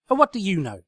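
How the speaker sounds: loud high irritated snap; loud exasperated retort; snapped. Acted. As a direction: snap it back at the sofa. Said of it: "snapped Twilight".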